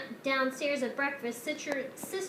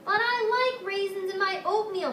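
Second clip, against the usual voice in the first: higher, louder whiny protest; 8 semitones higher, 5 dB louder than usual. A narrator's reading. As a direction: insist like a stubborn child. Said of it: "protested Sister".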